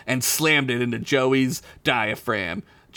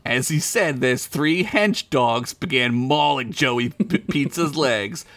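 normal talking voice

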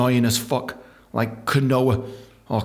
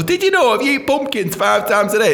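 imagined health announcer; 7 semitones higher than usual. Retold or imitated.

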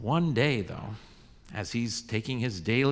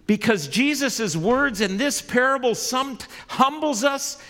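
louder and higher, driving sermon delivery; full-force preaching; 7 semitones higher, 6 dB louder than usual. Acted. Pastor presses the law on the congregation, preaching at full force.

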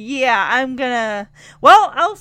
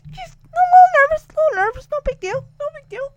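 squeaky much higher jittery self-reassurance; mock nervous; 13 semitones higher, 4 dB louder, faster than usual. Betty calming herself before Chuck, squeaky and jittery. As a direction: talk yourself down, half panicking.

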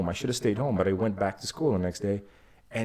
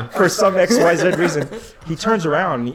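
ordinary talk, nothing marked

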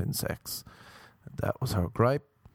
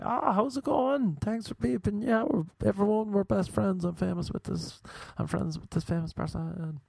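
gushing fan; 11 semitones higher than usual. Pretend. Greeting a famous driver who beeped, gushing high. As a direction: fawn over a celebrity, overdone.

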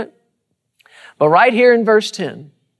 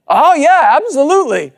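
eager agreement; 7 semitones higher, 3 dB louder than usual. Retold or imitated. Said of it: "You sound like a faith man".

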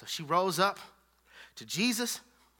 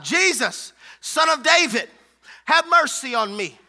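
raised, loud calling out; hollered cry; 4 semitones higher, 6 dB louder than usual. Acted. Blind Bartimaeus calls to Jesus passing by, hollering. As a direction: holler it out as a plea. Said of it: "and he hollers out".

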